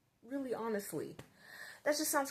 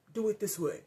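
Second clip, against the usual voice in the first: a hint of a stern teacher's snap; mock angry teacher; 4 dB louder than usual. Strict teacher ordering the child at her desk, mildly angry. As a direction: clip the order out like a cross teacher. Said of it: "and really angry".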